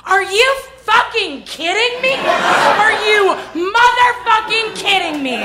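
high furious yelling; shrieking rage at the ex; screaming at him. Retold or imitated. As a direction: lose it and yell at him. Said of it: "dress him down at that volume".

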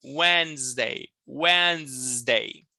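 "Wednesday" drawn out slowly as WENZ-day; teacher's slow "Wednesday" with no first D; silent first D. Shown as a demonstration. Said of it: "A gente vai eliminar ele".

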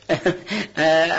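short uneasy laugh; nervous breathy laugh. Acted. (خندهٔ عصبی کوتاه)

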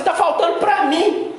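mimicking the believer's complaint; mock whiny complainer.

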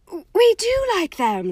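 high, slow fake cheer; lying too brightly; quick lie. A narrator's reading. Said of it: "lied Hermione at once".

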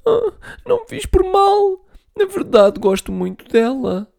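put-on very high crying little boy; sorry and tearful; sniffling. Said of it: "O Monty fungou". (a choramingar, muito agudo)

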